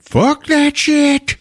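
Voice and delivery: very high, slow, drawn-out outburst; mock outraged refusal. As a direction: screech it high, stretching every word. Brother rejects dad's will.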